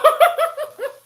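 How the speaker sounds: high, loud burst of laughter; real laugh. Real, not acted. Laughs at anime scene.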